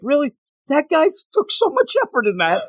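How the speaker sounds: high, indignant whine of complaint; mock outraged Deadpool. Deadpool whining at readers who flip past the art. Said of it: "And he's like".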